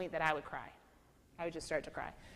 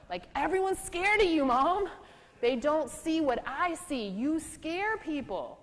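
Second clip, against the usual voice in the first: much higher and louder, slowing down at the end; pleading outburst; 9 semitones higher, 7 dB louder, slower than usual. Retold or imitated. Teen son telling his scary mom the truth, high and loud. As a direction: blurt it out to mom, then slow down to drive it home.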